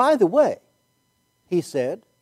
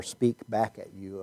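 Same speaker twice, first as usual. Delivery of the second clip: ordinary talk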